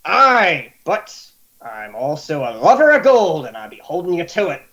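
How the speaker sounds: gruff pirate, rushing the end; a sly pirate driving a bargain; greedy pirate. Acted. Pirate reminding his new friend he loves gold.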